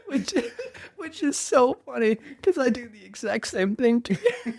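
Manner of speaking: high-pitched talk through laughter; real laughing. Real, not acted. Friend admits doing the same, laughing hard. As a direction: squeeze the words out while cracking up.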